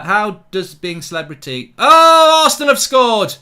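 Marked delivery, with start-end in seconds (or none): 1.8-3.3 much higher, much louder sudden yell; real outburst of dismay at the goal; goal shout. Real, not acted. Reacting to the goal live on stream, shocked shout. Rival scores during live watchalong.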